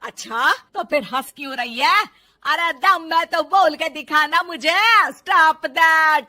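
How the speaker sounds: very loud, taunting mother-in-law; furious saas drawing out the dare; angry challenge. Acted. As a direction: dare her at full volume. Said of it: "फिर बहू पर गुस्सा आ जाता है".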